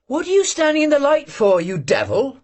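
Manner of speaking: sudden high shout. A narrator's reading. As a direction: snap at him to move. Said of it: "the girl suddenly shouted".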